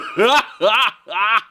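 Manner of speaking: hearty rising laugh; sun's laugh. Acted. The sun wakes and sees the bat, rising laugh. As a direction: let a big warm laugh roll out. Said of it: "the sun began to".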